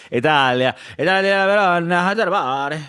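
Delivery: playful fake Italian accent; mock Italian. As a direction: sing out the Italian place names like a mock Italian. Hamming up Italian over Peroni.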